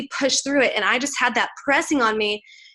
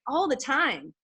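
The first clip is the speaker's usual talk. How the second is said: ordinary talk, nothing marked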